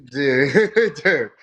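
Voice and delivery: laughing out loud dude. Real, not acted. Laughing outburst.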